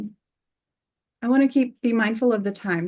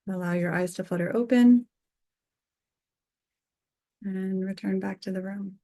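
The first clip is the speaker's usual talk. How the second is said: guided meditation. Acted. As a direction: gently bring them back to the room.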